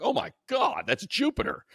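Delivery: ordinary talk, nothing marked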